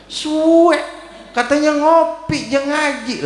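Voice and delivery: very high, drawn-out devil's whine; annoyed devil. Acted. Devil finds he was tricked about coffee, annoyed high cry. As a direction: wail it like a tricked, exasperated devil. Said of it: "kan jengkel setan".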